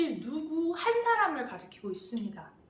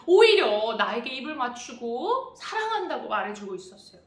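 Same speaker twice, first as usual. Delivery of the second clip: ordinary talk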